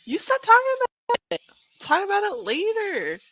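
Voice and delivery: high, laughing through the words. Real, not acted. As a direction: giggle it out, embarrassed. Embarrassed after spill.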